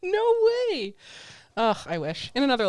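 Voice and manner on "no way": high, gasping mock disbelief; starstruck fan. Fan spotting a movie billboard, mock starstruck gasp. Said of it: "they were like".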